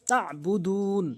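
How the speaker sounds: "তাবুদুন" with throat ayn, long final vowel; ayn and stretch. Shown as a demonstration. Teacher modelling the verse ending, "তাবুদুন" slow and stretched. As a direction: draw out "তাবুদুন" slowly. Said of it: "বলতে হবে".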